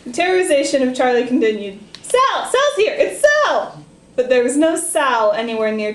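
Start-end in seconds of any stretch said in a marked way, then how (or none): 2.0-3.8 excited calling out. Acted. Charlie hallucinating, calling out.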